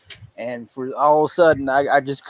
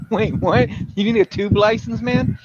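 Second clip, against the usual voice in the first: laughing disbelief; 7 semitones higher, 3 dB louder than usual. Real, not acted.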